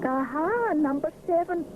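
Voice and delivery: high, pleased declaration; lightly proud; proud announcement. Acted. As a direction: present it proudly. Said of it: "he announces proudly".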